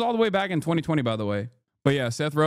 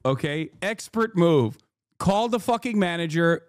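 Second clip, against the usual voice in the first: normal talking voice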